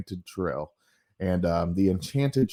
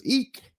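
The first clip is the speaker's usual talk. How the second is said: high squeaky "Eek!" of a startled woman; mock scream. Startled woman spotting the ghost shrieks high. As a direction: shriek it like a cartoon lady. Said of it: "A scream".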